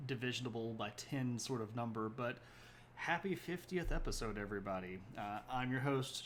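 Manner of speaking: ordinary talk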